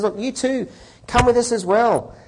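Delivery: high, breezy lawyer. Acted. Lawyer voiced in joke.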